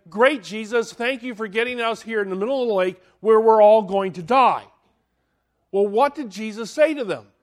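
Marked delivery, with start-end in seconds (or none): 0.1-4.7 fast, loud mock complaint; hammed-up panicked sarcasm; sarcastic disciples. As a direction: whine it sarcastically at Jesus. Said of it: "the disciples say".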